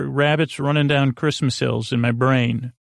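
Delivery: ordinary delivery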